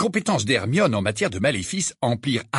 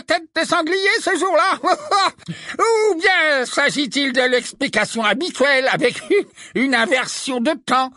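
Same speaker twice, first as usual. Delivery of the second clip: much higher, louder, sneering and chuckling; mocking minister; 10 semitones higher, 3 dB louder than usual. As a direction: gloat over a weak excuse, laughing. Fudge mocking.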